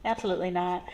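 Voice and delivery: soft, dismissive "mm-mm"; firm refusal. Real, not acted. Nurse refusing the patient, dismissive. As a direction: shut it down with attitude. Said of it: "flat refusal aside".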